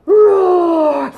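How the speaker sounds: high, loud playful roar; dinosaur roar. Pretend. Dinosaur roars.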